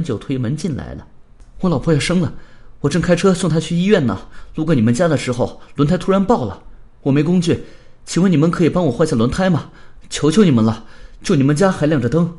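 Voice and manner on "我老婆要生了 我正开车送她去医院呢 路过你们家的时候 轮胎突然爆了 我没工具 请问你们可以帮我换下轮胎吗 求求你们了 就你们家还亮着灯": fast, raised urgent pleading; anxious plea. Acted. Glasses man begging strangers to change his tire, anxious and fast. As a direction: beg for help in a loud rush. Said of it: "很焦急的样子".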